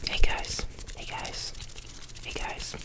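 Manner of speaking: breathy whisper close to the mic; cupped hand whisper. Real, not acted. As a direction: hush each greeting into the mic. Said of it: "short inaudible whisper".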